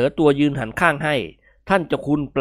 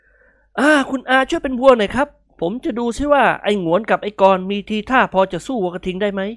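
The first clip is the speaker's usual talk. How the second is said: put-on politely egging the old man on; playful pitched-up plea; cheeky young man; 7 semitones higher than usual. A narrator's reading.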